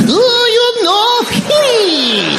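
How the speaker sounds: piercingly high, drawn-out mock Japanese-accented wail; absurdly hammed-up Japanese accent, very slow; over-the-top Japanese accent. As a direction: stretch it into a screeching Japanese caricature. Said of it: "That's exactly what she said".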